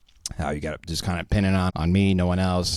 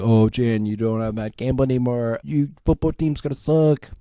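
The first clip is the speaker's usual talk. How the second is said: higher-pitched mocking jeer; mocking taunters; 3 semitones higher, 4 dB louder than usual. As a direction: imitate jeering fans in a silly raised voice. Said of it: "people are gonna be like".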